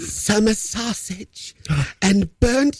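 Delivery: creepy voice. Acted. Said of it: "in a creepy voice".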